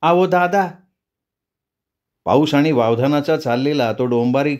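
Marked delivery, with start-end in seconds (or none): 0.0-0.7 high, distant call to the brother; a woman's voice calling through the storm; ghostly woman calling. Acted. Woman calling the narrator from the dark, high and distant.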